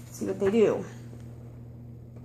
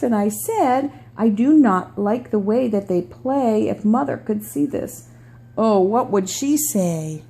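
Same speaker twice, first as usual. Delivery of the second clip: storybook read-aloud voice; reading to children. Acted. Reads picture book aloud.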